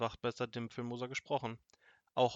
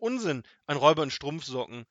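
loud, scornful dismissal; indignant, punched-out disbelief; dismissive exclamation; 2 semitones higher, 8 dB louder than usual. A narrator's reading. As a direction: wave it off as rubbish. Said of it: "rief er".

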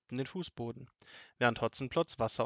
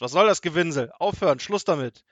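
angry, higher, very loud order; threatening shout; 5 semitones higher, 10 dB louder than usual. A narrator's reading. Robber threatens wailing Seppel, angry shout. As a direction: shout down the whining. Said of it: "drohte ihm mit der Faust".

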